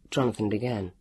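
usual speaking voice

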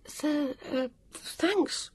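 faltering, high-pitched thanks; barely managed. A narrator's reading. Vivian takes in alarming news, managing a high, faltering thanks. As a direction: stammer it out high, struggling to get the word out. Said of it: "Vivian managed to say".

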